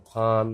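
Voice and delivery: ফান said with a clear ফ onset, loud; teacher models ফান with ফ; ফ sound. Shown as a demonstration. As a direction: demo of ফান with Bengali ফ. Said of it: "এফ এর উচ্চারণটা ফয়ের".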